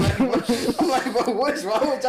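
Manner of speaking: high, loud and laughing at the absurdity; laughing disbelief. Real, not acted. Laughing at the caller.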